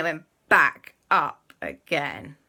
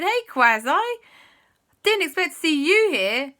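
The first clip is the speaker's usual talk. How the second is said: high, loud hail to a friend; surprised greeting; 10 semitones higher, 7 dB louder than usual. Acted. Friends bump into Quasi in the queue, surprised greeting. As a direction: call out in happy surprise.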